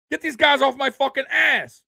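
high, loud furious yell; Peyton Manning yelling. Retold or imitated. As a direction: scream it at the linemen. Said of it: "You've seen Peyton Manning yell at offensive linemen".

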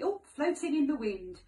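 ordinary delivery

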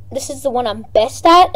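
ordinary talk

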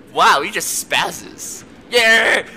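ordinary talk, nothing marked

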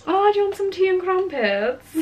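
high, hammed-up British accent; put-on British accent. Shown as a demonstration. Vlogger mocking British tea manners, high put-on British accent. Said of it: "trying to do a British accent".

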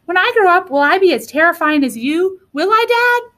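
put-on high, eager child dinosaur voice; Rex. A narrator's reading. Little dinosaur asking about growing up, high eager voice. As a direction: ask like a hopeful small child, rising at the end. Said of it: "said Rex".

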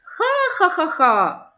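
slow, drawn-out mocking laugh; mocking laughter. Boy laughing at the shepherds he fooled, slow mock laugh. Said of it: "хлопець заходиться сміхом".

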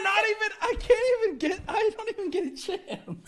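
high and loud through laughter; breaking up laughing mid-sentence; laughing hard. Real, not acted. Laughing fit during challenge.

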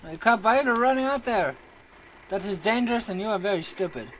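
high, loud, exasperated telling-off; loud, indignant reprimand; alarmed scolding. Real, not acted. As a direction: shout at a reckless teammate. Scolds teammate running into danger.

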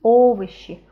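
"Овощи" with the first O punched loud; stressed first syllable. Shown as a demonstration. Teacher showing where stress falls, loud first O in "Овощи". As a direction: lean hard on the O opening "Овощи". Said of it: "here it is stressed".

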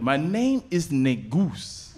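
slow put-on African accent. Customer giving a name at Starbucks, in African accent. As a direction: announce it deliberately in a hammed-up African accent. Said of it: "in my best African accent".

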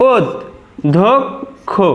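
ওদ, ধোক, খো with long pauses between; correct pronunciation, syllabified. Shown as a demonstration. Teacher showing students the correct pronunciation, one syllable at a time. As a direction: say ওদ then ধোক then খো, each apart. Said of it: "আমাদের শুদ্ধ উচ্চারণটা দেখে নাও".

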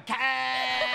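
"care" high and endlessly drawn out, Cockney vowel; endless Cockney "cow". Retold or imitated. Host mimicking someone still going after you return, stretched Cockney "care". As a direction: hold the vowel of "care" as a long Cockney yell. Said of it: "you come back, they still go".

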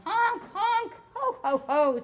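high honks, then laughing ho ho; honking goose laugh. Acted. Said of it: "said the goose laugh".

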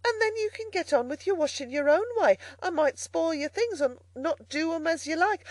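high-pitched, mildly anxious persuading; frightened. A narrator's reading. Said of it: "now thoroughly frightened".

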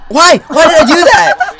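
high, loud self-reproach; exasperated yell. Real, not acted. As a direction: wail at your own blunder. Anguished at own game mistake.

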